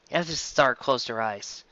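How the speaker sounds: ordinary delivery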